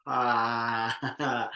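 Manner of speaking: long open ah breaking into a chuckle; pouring-out ah. Shown as a demonstration. Leader sending voice toward imagined clients, full open ah. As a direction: release a full ah outward. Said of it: "pour out of your voice and your mouth".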